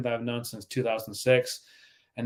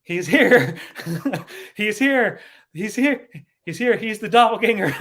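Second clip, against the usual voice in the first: excited announcing; 7 semitones higher, 6 dB louder than usual. Real, not acted. Friend's double joins chat.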